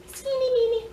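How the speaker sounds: very high little voice; squeaky toy name. Pretend. Vlogger names the skinny twin toy, playful high voice.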